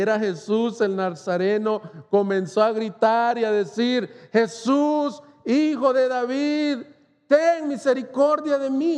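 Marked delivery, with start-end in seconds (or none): 4.3-9.0 higher, pleading call, a shout kept in check; held-back cry for mercy. Retold or imitated. Preacher voicing the blind man calling to Jesus, restrained plea. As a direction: cry out to Jesus but hold back the full scream. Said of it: "Yo no quiero gritar como él realmente gritó".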